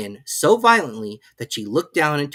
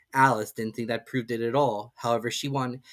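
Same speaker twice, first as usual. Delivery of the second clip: ordinary delivery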